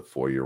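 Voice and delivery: foyer said FOY-er, with a plain English r; English pronunciation. Real, not acted. Co-host reading the article aloud, foyer as anglicized FOY-er. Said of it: "translate it from French to English, it's foyer".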